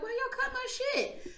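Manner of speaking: high squeaky gnome voice; whiny gnome. Retold or imitated. Angry gnome whines at the girls after losing his beard. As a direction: squeak it out like an indignant little gnome. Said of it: "And he's like".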